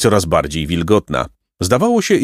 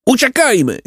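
sudden high shout to flee. A narrator's reading. Boy shouting to his brother to flee in panic. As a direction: yell it out, high and sharp. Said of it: "krzyknął Jacek".

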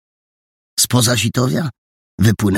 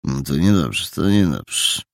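low, grumbled disapproval; displeased officer's low mumble; muttering sergeant; 5 semitones lower than usual. A narrator's reading. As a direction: mutter it gruffly to yourself. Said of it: "mruknął sierżant".

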